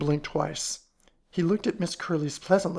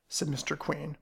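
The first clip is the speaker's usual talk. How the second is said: plain reading voice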